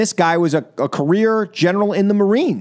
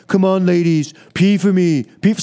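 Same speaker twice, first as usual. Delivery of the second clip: mock Trump voice. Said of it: "he was rooting him on".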